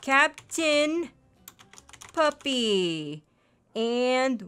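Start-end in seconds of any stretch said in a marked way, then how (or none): none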